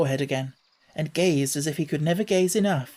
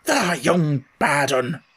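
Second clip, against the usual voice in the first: put-on slow, drawn-out gruff old Yorkshireman; old man calling down; 3 dB louder, slower than usual. Ben calling down from the wall, slow scornful old voice. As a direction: call it down at her, slowly. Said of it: "he called down to her".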